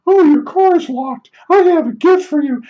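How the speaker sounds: high falsetto, sing-song and sweet; mocking the neighbor. Neighbor at my locked car offering a gift, high sing-song falsetto. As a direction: trill it like a clueless sweet old lady.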